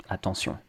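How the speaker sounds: attention softly as ah-ton-syon; French pronunciation. Shown as a demonstration. Teacher repeats for learners, French pronunciation of attention. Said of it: "Whereas in French".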